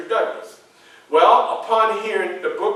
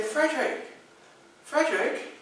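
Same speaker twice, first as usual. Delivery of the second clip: lady's call; 9 semitones higher, 5 dB quieter than usual. Retold or imitated. Young ladies at the party calling Frederick: high soft coo. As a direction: coo his name like a charming young lady. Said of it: "that's how they were speaking".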